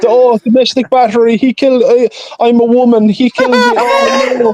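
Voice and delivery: hammed-up wailing victim; mock victim's wail. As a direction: play the hysterical accuser, mockingly. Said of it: "That's what he would say".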